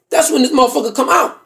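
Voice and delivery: normal talking voice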